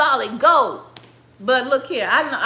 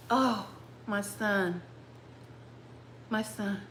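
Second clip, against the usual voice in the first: soft, halting lament; stricken father; 3 semitones lower, 13 dB quieter, slower than usual. Acted. Father realises he blessed the wrong son, hushed and stricken. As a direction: let it sink in, hushed, long pauses.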